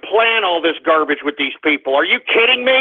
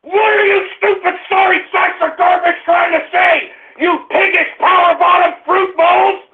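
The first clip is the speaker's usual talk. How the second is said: furious shouting; 10 semitones higher than usual. Real, not acted. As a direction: scream insults at the callers. Host's mock rant at callers.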